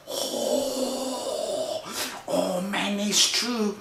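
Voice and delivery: high, hushed gasp of realisation; dawning dread. Acted. Little brother admits fear of the cat, soft and high.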